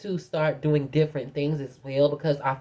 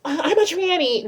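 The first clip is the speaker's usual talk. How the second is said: much higher, stammered mock confession; mock announcement; 12 semitones higher, 4 dB louder than usual. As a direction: parody someone blurting it out to strangers. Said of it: "Don't nobody walk around".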